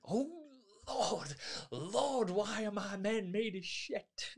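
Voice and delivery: high, slow despairing mock wail; exasperated leader. Gang leader despairing of his men, high and slow. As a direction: appeal to heaven over useless men. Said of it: "he has this mannerisms".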